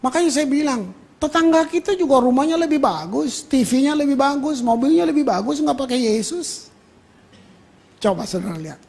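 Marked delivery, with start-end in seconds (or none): none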